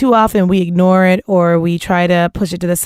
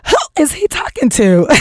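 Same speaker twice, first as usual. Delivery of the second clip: shocked disbelief; 5 semitones higher than usual. Retold or imitated. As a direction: recoil at what he just said. Shocked reaction to his command.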